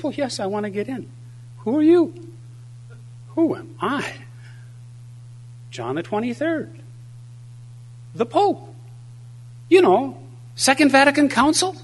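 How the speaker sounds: high-pitched, hammed-up exasperation; indignant Pope. Pope at the pearly gates to the Holy Spirit, hammed-up indignation. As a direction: insist in a high voice, amazed not to be known.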